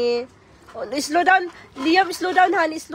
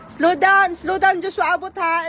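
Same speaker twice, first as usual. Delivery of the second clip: sharp playground warning; 6 semitones higher, 5 dB louder than usual. Real, not acted. Mom calling to biking child.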